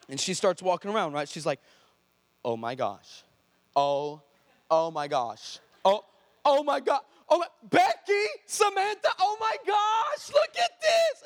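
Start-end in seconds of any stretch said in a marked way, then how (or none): 2.4-5.6 low, hushed-pitch amazement in an acted quote; stunned disbelief. Cheerleader tests her healed knee, acted stunned disbelief. 5.8-11.2 imitated excited shriek, rising to very high and loud; freaking out. Cheerleader shows friends her healed knee, imitated freak-out shriek. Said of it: "she starts freaking out".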